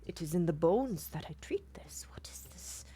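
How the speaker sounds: unvoiced whisper to himself; whispering healer. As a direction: mutter it under your breath in awe. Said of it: "looks back at the treatment".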